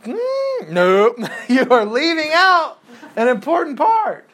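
high, loud sing-song protest with a laugh; mock objection. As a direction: play the know-it-all student piping up. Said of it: "And I'm".

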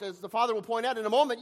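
usual speaking voice

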